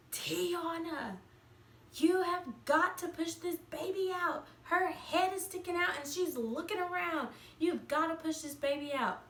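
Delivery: high, pushy mother's plea; urgent mom. Retold or imitated. Mom urging her daughter to push the baby out, high and insistent.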